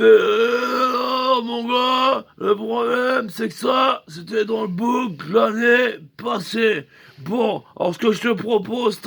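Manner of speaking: hammed-up despairing cry over last year's catalogue; mock wailing tattooist. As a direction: break the news like it's a tragedy. Tattooist wails over old design.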